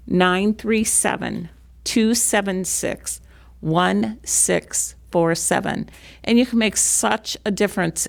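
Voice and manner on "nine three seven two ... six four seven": slow, evenly spaced digits. Real, not acted. Repeating the phone number for interested foster parents, slowly. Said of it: "I'll say it slowly".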